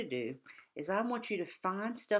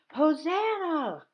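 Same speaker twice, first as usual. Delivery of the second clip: long, high, loud praise cry; joyful shout; 10 semitones higher, 8 dB louder, much slower than usual. Retold or imitated. Child by the road greeting Jesus, drawn-out joyful shout. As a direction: stretch it out like a crowd by the road. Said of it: "waving my palm branch and shouting".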